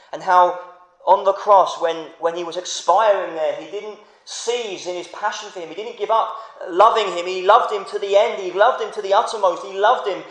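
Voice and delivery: rising, loud fervent preaching; preaching at full force. Real, not acted. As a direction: drive home Christ's love with passion. Preaching climax on the cross.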